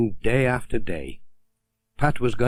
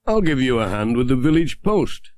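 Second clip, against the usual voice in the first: Ted's voice; 3 semitones higher than usual. Acted. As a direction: offer help cheerfully in a villager voice. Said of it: "said Ted".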